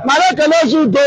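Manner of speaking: ordinary talk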